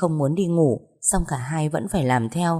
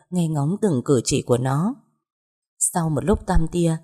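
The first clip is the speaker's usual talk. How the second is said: usual speaking voice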